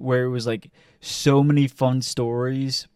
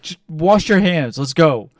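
louder, pressing order to leave; urgent command; 4 semitones higher, 6 dB louder than usual. Retold or imitated. Telling friend to wash hands and go, urgent and loud. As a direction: hurry him out firmly.

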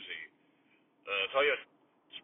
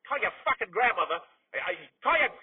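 angry outburst; 5 semitones higher, 3 dB louder than usual. Acted. Swearing at nephew on air.

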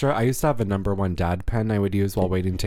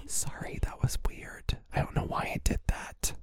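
hushed, voiceless apology; imitated whisper; 10 dB quieter than usual. Imitating co-host apologising for playing with a drink, whispered. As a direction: whisper it like a sheepish aside. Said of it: "How many times does Stephanie whisper".

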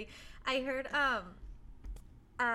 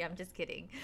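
ordinary talk, nothing marked

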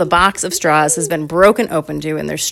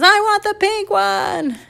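put-on high, drawn-out child's wail; mock crying; 3 dB louder, slower than usual. Bar loses the pink straw, in a mock crying wail. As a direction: imitate a kid bawling, stretching the words. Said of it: "Bar just starts crying".

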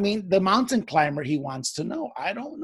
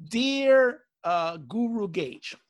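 slow letter reading; 4 semitones lower, much slower than usual. Acted.

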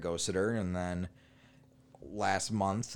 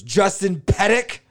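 high, slow, rising call of a name; 9 semitones higher, 10 dB louder, slower than usual. Retold or imitated. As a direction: call out "Justin Pettick?" high, drawn out and rising. Ex calls his name at counter.

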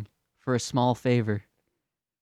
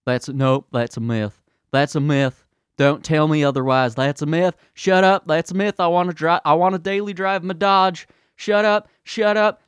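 put-on higher, louder, childish ranting denier; mock denier tantrum; 6 semitones higher, 5 dB louder than usual. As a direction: plug your ears and yell like a spoiled kid. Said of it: "they're like".

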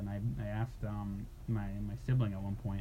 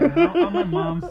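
higher, louder words broken by real laughter; laughing while talking; 12 dB louder than usual. Real, not acted. Still laughing at the joke, talking through it.

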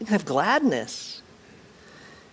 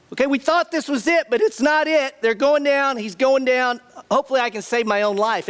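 much higher, fast, frantic voice; frantic disciple; 8 semitones higher, faster than usual. Retold or imitated. Disciple deciding to flee the arrest, much higher and frantic. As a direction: gabble it out like a scared follower saving his skin.

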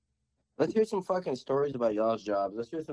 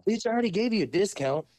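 indignant; 8 semitones higher than usual. Retold or imitated. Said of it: "my first thought is".

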